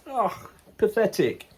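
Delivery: ordinary talk, nothing marked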